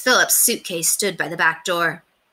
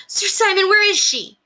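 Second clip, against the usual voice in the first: high, loud cry of alarm; cried out loud and high; urgent cry; 14 semitones higher, 5 dB louder than usual. A narrator's reading. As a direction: call out urgently, pitched right up. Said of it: "William cried".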